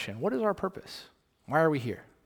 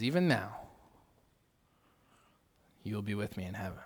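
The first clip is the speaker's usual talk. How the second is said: much softer, low, tender reassurance; tender promise; 5 semitones lower, 7 dB quieter than usual. Retold or imitated. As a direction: promise him gently. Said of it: "Jesus looks at him and he says".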